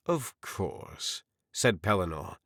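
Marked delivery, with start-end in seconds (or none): 0.1-1.2 trying to sound civil. A narrator's reading. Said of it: "trying to sound civil".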